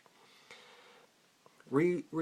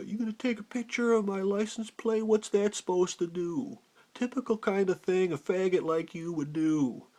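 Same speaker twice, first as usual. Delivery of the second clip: higher, put-on ranting voice; mock yelling; 4 semitones higher, 3 dB louder than usual. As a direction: imitate an angry man yelling taunts. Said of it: "And he's yelling".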